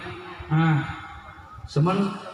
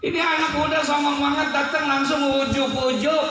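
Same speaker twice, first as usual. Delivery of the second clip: sermon storytelling pitched way up; high-pitched preaching; 11 semitones higher than usual. Acted. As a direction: tell it in a high, calling preacher's voice. Preacher retells at raised pitch.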